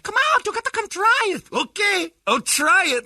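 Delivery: very high falsetto wife coaxing. Retold or imitated. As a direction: coax him out in a high woman's voice. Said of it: "his wife said".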